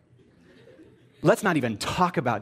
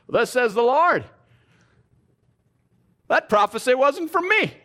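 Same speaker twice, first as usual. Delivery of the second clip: higher and louder, hammed-up Pentecostal proclaiming; Pentecostal prophet tone; 9 semitones higher, 4 dB louder than usual. A moron in the back mimicked as a Pentecostal prophet proclaiming loudly. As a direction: boom it out like a revival preacher. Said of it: "good old-fashioned Pentecostal tone says".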